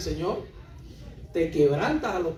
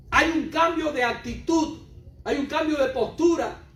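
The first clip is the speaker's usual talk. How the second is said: louder, higher preaching at full force; fiery preaching; 4 semitones higher, 3 dB louder than usual. Acted. Preacher pressing the congregation about the humble man's posture, fiery preaching. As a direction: drive the point home to the congregation.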